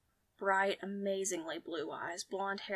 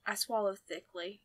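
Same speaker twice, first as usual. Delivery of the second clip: plain reading voice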